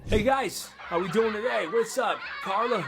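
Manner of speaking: high, cocky school bully voice. Retold or imitated. Popular bully greeting friends and mocking Steven, cocky and high. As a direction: swagger through the hallway taunts. Bully arrives with bad haircut.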